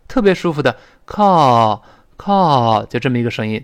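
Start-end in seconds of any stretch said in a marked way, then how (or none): 1.0-2.9 American "call" with open, sighing ah; slow, relaxed American "call"; American pronunciation. Shown as a demonstration. Teacher shows learners the American vowel in call, American accent. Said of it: "但美音中这个哦的声音呢".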